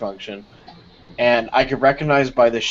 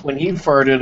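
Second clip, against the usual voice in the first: normal talking voice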